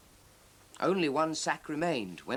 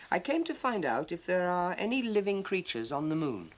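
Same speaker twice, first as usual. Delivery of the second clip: lightly voiced earnest spaceman, a bit higher; 6 semitones higher than usual. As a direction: explain the mission, just slightly raised. Spaceman explains to Father Christmas.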